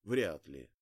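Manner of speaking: ordinary delivery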